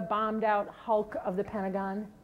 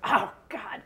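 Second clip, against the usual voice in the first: a breathless whispered groan of dismay; 3 semitones higher than usual. Retold or imitated. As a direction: whisper it as the sinking realisation hits. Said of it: "your intuition goes".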